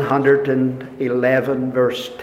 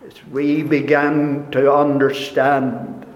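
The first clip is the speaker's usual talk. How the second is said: normal talking voice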